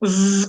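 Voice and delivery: buzzing zzz through closed teeth; zumbido entre dientes. Shown as a demonstration. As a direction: hold a long buzz with the teeth shut. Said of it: "con los dientes cerrados". (zumbido largo con los dientes cerrados)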